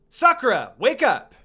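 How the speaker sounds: loud call to snap her out; loud, high call to wake a friend. A narrator's reading. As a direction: shout her awake. Shaking a daydreaming friend.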